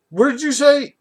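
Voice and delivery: high, loud, shocked question. Retold or imitated. Father reacting to being yelled at, shocked. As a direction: imitate a stunned man's challenge. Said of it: "like, all shocked".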